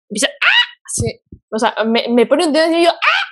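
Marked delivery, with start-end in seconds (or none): 0.3-0.7 shrill bleating scream, much higher; baby goat scream. Retold or imitated. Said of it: "yo grito como una, ¿cómo se llama? Como una cabra bebé". 2.9-3.3 short piercing bleat, much higher; baby goat scream. Retold or imitated. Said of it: "Y así empiezo a gritar horrible".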